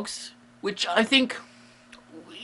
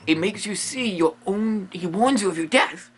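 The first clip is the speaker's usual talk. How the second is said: normal talking voice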